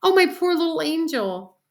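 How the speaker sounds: high, loud doting dismay; distraught mother fussing; crying out. A narrator's reading. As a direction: lament over your poor child. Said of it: "my mother cried".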